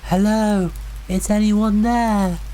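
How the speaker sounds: high, drawn-out call of a lost woman; distant woman calling. A narrator's reading. Woman lost in the woods calling out to campers, high and slow. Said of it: "The voice called again".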